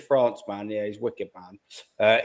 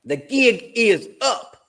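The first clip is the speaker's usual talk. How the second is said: put-on much higher and very slow, stretched out in Matty's accent; 9 semitones higher, 4 dB louder, much slower than usual. Streamer hams up Matty the Southern Gooner's catchphrase, slow and high. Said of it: "as he would say it".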